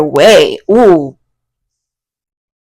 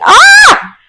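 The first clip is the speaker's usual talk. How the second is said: high, loud squeal of excitement; excited scream; 5 dB louder than usual. Real, not acted. Reader thrilled by a client's reading, excited scream. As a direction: let out a piercing happy shriek. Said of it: "That's so exciting".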